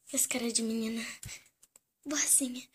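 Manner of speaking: softly whispered plan; whispered aside. Acted. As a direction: whisper it under your breath. Whispers to herself. (sussurrando pra si mesma)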